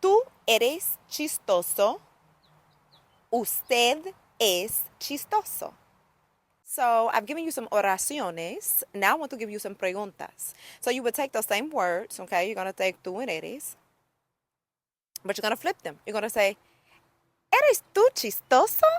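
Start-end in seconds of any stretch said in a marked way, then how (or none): 0.0-5.7 very slow, word by word, pitch raised; careful teacher modelling, each word separate; slow close-up demo. Shown as a demonstration. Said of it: "get up close so you can get those". 17.5-18.9 "¿Eres tú chistoso?" pitched high, as a question; exaggerated question melody on "¿Eres tú chistoso?". Shown as a demonstration. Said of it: "listen to my tone".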